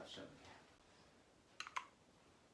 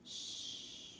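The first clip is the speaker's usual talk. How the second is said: soft whispered shush; hushing shh; 6 dB quieter than usual. Acted. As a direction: hush them to sleep. Bedtime hush.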